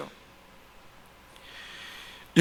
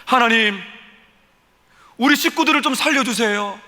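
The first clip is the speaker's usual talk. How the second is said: urgent example prayer; 6 semitones higher, 4 dB louder than usual. Acted. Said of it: "어떤 의미입니까?".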